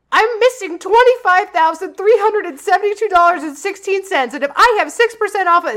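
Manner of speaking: lightly voiced screaming customer demanding money; mock angry caller. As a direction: rattle off the numbers like an upset caller. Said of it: "this person's screaming at you".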